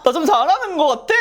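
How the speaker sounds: bright, high and loud self-praise; excited boast. Real, not acted. To her viewers, delighted with her own makeup work, excited. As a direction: cheer yourself on, thrilled.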